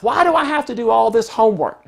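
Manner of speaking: high, loud whine about homework; whiny child complaint. Acted. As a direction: complain like a put-upon kid.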